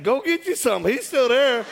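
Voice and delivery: high-pitched, gleeful invitation; excited egging on. Retold or imitated. Counselor inviting pastors to join in, excited egging on.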